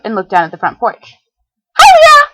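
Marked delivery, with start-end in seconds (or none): 1.8-2.3 very high, loud, bubbly call; cheery shout. Acted. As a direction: sing out a giddy greeting. Pinkie greets from the porch.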